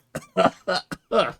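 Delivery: high, choked hacking; coughing fit. Real, not acted. Player dying after the silly voice, real coughing fit. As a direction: cough hard after straining the voice.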